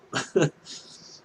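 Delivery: a short, high giggle at himself; embarrassed laugh. Real, not acted. Storyteller laughing at his own overshare, high giggle. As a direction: laugh off the too-much-info remark.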